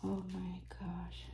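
whispered amazement; awed whisper. Real, not acted. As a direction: breathe it out in wonder. Awed over the doll.

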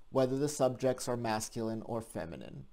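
ordinary talk, nothing marked

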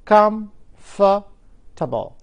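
comfortable with stress on the first syllable, drawn out; stress on COM. Shown as a demonstration. Teacher models the word for students, slow and stressed. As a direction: stretch out comfortable, leaning on COM. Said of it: "هنطقها وأقول".

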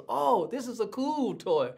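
put-on light up like a kid seeing the toy; excited grandson. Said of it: "Then he goes".

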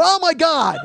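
high, loud outburst of despair; exasperated yell. Retold or imitated. Man learning she dislikes Kit Kats, yelling in exasperation. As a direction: explode in high, loud disbelief.

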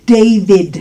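"David" as DEY-vid with a firm English D. Shown as a demonstration. Teacher corrects learners on "David", clear English DEY-vid. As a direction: stress the first syllable of "David", drawn slowly. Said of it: "se dice David".

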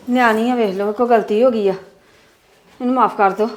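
soft, low begging for forgiveness; pleading softly. Acted. Mother softly begs the father to forgive their daughter, pleading. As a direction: plead gently, voice dropped low.

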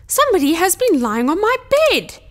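loud, indignant Father Bear, pitch pushed up; big bear's loud, high outrage; gruff growl. A narrator's reading. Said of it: "Father Bear growled in his big, gruff voice".

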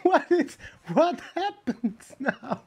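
high, loud stunned disbelief; can't believe what you just saw; shocked. Real, not acted. Stunned watching trick video.

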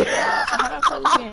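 laughing shout. Real, not acted. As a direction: shriek it while laughing.

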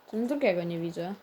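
usual speaking voice

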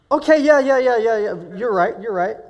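loud, high, rapid-fire agreement; so excited. Retold or imitated. Man cutting off his calm friend's advice, overexcited. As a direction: hurry through agreeing, then burst out amazed. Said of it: "I'm so excited".

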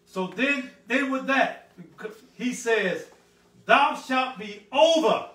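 loud, high pulpit proclamation; booming sermon build; full-force preaching. Acted. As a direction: preach it big, stretching out the scripture.

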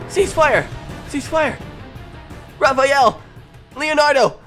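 usual speaking voice

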